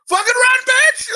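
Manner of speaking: high-pitched yell at the movie screen; shouting out loud. Retold or imitated. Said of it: "Like, like, out loud".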